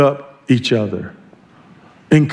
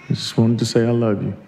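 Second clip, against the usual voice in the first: low, soft, quick murmur; softly voiced explanation to a loved one; tender reassurance; 4 semitones lower, 5 dB quieter, faster than usual. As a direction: say it gently, a little sheepishly. Acted reply in text exchange.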